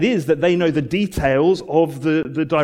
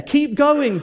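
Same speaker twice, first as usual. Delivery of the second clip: rousing encouragement; 10 semitones higher, 3 dB louder than usual. Acted. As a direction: Keep going called out high. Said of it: "We'd say".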